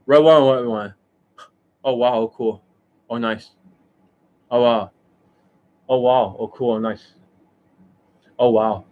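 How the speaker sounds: mock-impressed coach, repeated little reactions; Justin Wayne. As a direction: lightly send up his automatic approval. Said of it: "that is the Justin Wayne".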